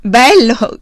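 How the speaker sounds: loud, high cry of disbelief; shouted exclamation. A narrator's reading. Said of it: "gridò".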